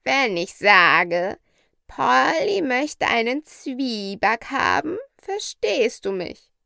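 high, slow, demonstrative parrot voice; talking parrot. Acted.

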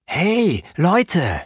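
high, loud eager hey; excited call. A narrator's reading. Boy calling his friends with a sudden idea, excited.

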